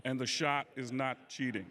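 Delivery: a hint of Barkley's gruff griping; lightly voiced Barkley complaining; Charles Barkley impression. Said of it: "Charles Barkley thinks everything's terrible".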